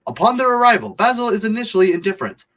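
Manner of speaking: high-pitched, dramatic storyteller; theatrical narration. Acted. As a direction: launch into it high, like a grand narrator. Retake of theatrical reading.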